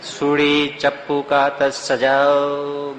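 slow chanted street call; knife-sharpener hawker's cry. Retold or imitated. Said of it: "સજાવા વાળા".

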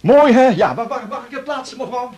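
high, loud gushing; eager salesman. Acted. Salesman fussing where to put the bouquet, gushing. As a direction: fuss over the bouquet.

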